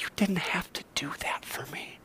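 soft, broken whisper of gratitude; barely voiced, as if holding back tears; mother-in-law whispering through tears to Jesus. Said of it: "through tears".

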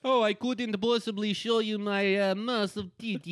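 high, slow coy voice; simpering. Retold or imitated. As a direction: play it coy and breathily innocent. Porn actress voiced.

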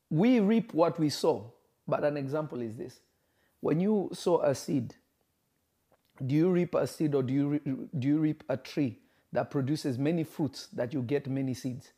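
normal talking voice

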